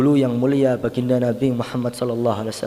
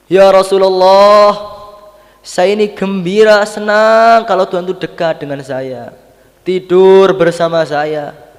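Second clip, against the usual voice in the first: impassioned reply; 8 semitones higher, 11 dB louder than usual. Retold or imitated. Wife answers her husband's request to worship, impassioned. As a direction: proclaim it devotedly, raised in pitch and volume. Acting Aisyah's joyful, devoted answer.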